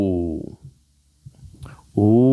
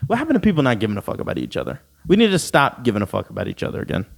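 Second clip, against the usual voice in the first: rapid rant; much faster than usual. Real, not acted. Rushed rant.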